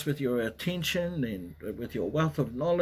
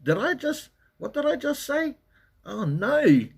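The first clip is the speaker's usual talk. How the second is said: high, rising alarm at own words; panicked inner thought; 12 semitones higher, 4 dB louder than usual. Retold or imitated. Streamer retelling his thought after a flubbed joke, high panicked squeak. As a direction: squeak it out like you just caught your slip. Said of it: "I was thinking".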